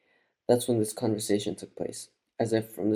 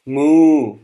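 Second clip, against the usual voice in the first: long, high, loud cow moo; 5 semitones higher, 13 dB louder than usual. A narrator's reading. Woman reliving the cow's cry, long loud moo. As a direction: moo out at full voice, drawn out. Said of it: "the woman moos".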